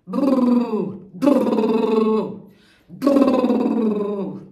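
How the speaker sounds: loud buzzing lip trill. Shown as a demonstration. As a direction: blow a loud lip roll. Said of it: "des exercices pour les lèvres".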